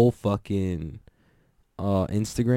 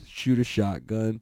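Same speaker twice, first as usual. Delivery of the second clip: ordinary talk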